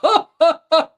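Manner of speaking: loud high laugh; real gleeful laugh; delighted laugh. Real, not acted. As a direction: burst out laughing in glee. Laughs after goal celebration.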